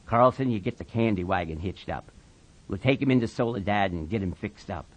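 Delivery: low, soft, quick orders; quiet, deep authority; calm, commanding Slim. Acted. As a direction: take charge without raising the voice.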